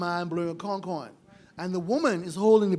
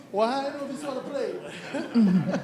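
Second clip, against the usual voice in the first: laughing; 5 semitones higher, slower than usual. Real, not acted. Laughing at her own remark.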